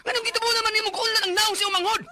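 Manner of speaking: high, loud crying rebuke; angry scolding. Acted. Parent scolding son over little sibling, crying angry rebuke. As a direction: scold through tears of rage.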